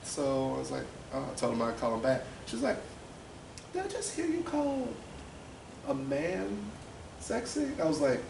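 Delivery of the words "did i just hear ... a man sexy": high-pitched imitation of a suspicious aunt; incredulous questioning. Aunt questioning what she overheard.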